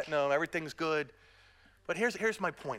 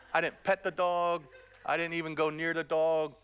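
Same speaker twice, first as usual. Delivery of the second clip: ordinary delivery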